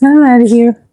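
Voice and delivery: ordinary talk, nothing marked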